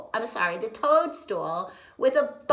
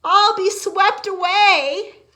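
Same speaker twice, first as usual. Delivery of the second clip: panicked; 10 semitones higher, 12 dB louder than usual. Acted. Frog fearing the flood.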